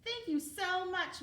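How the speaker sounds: grateful. Acted. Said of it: "Cornelius said".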